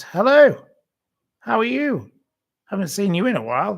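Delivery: high, loud, delighted hello; enthusiastic greeting. Retold or imitated. Shop man greeting returning customer, high delighted hello. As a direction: greet an old acquaintance with a burst of delight.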